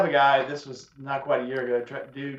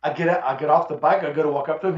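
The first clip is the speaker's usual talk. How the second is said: ordinary talk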